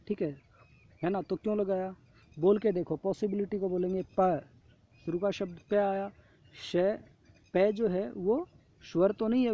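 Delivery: ordinary talk, nothing marked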